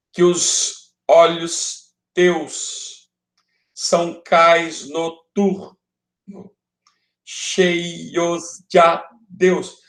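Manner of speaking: verse in slow, detached syllables; escansão. Shown as a demonstration. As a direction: scan each line slowly, syllable by syllable, with gaps. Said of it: "Os dois A juntos aqui". (verso escandido devagar, sílaba por sílaba)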